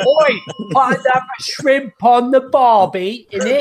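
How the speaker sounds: slow hammed-up Aussie accent; laconic outback drawl. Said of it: "Like when we say".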